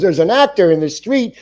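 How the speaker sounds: high, loud breaking-news cry; acted excitement. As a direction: imitate someone calling out big news. Kid announcing the actor, retold.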